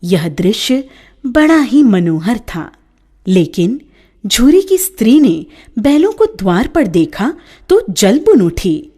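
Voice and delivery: ordinary delivery, nothing marked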